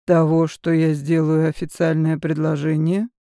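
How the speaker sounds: ordinary delivery, nothing marked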